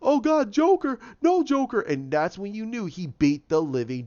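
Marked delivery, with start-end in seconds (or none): 0.0-1.8 much higher and louder panicked pleading; hammed-up begging for mercy from the Joker; mock terrified plea. Retold victim begging Joker before the beating, high mock panic. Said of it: "the last words that Common, the tattoo man said is".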